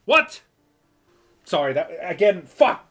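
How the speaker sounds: loud high startled yell; real outburst. Real, not acted. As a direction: blurt it out in shock. Streamer startled by game event.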